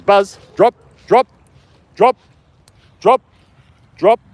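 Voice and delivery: real loud command to a dog; firm dog command. Real, not acted. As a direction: order the dog sharply, pausing between words. Ordering the dog to drop ball.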